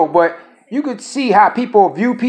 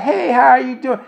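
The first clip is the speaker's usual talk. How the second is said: put-on higher, lilting child-directed greeting; sing-song voice; 5 semitones higher than usual. Imitating people greeting a child, mocking sing-song. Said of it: "talk to people with a sing-song voice".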